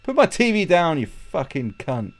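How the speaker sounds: high, loud yell slowing into the insult; mock angry yelling. Householder to a guest taking the TV, mock outrage. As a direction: stretch out the final insult, hammed-up outrage. Same acted quarrel with thieving guest.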